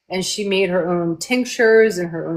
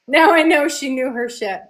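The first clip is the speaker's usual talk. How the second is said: laughing; 6 semitones higher than usual. Real, not acted. Host laughs while praising her friend's insight. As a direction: laugh then higher laughing line.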